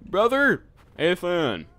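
shouting a name, high and loud; calling out. Acted. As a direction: yell into the night. Calling into the dark woods.

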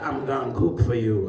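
a hint of a low, smooth come-on; mock seductive cook offer; imitating the cook's come-on line; lightly voiced skinny cook. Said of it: "He keep waving his waist at me like this".

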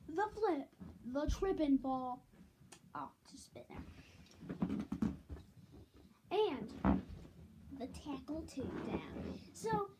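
ordinary talk, nothing marked

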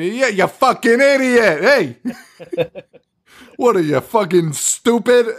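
half-laughing mock dad yelling at a dog; mock fifties dad. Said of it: "like a nineteen fifties dad getting bit by a dog".

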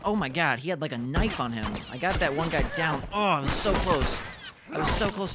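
loud, exasperated groaning at the loss; real gamer frustration; frustrated outburst. Real, not acted. Frustrated gamer losing a fight.

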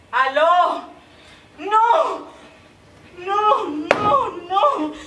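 loud, high cries into a dead line; desperate shouting. Acted. Woman shouting into the phone after the line cuts out. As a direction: shout into the phone as the call drops. Call drops, she cries out.

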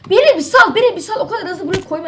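loud high-pitched desperate yell; high shout. Real, not acted. Gamer shrieks high and loud at a blocking opponent. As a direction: scream at him to let you go.

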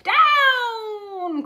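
high, loud shout of "Down!"; the witch commands the broom down; shrill call from a witch steering her broom; witch's cry. A narrator's reading. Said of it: "cried the witch".

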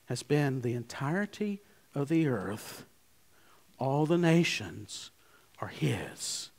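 fading down to a whisper; hushed whisper. Real, not acted. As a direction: let it sink to a breathy whisper. Preacher drops to a whisper.

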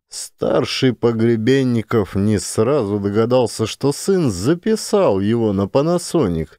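ordinary delivery, nothing marked

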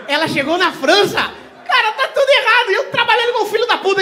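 high, loud laughing disbelief; incredulous shout. Real, not acted. Comic to a French fan of Deolane, shouting in disbelief. Stunned audience member knows Deolane.

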